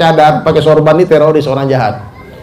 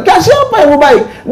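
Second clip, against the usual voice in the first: mocking tone; 11 semitones higher, slower than usual. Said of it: "Sedikit bilang".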